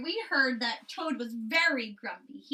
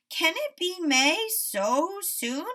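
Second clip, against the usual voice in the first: higher, slow and puzzled; a sleepy Toad in disbelief, slow and higher; surprised Toad; 8 semitones higher, 7 dB louder, much slower than usual. A narrator's reading. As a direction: wonder aloud, half awake.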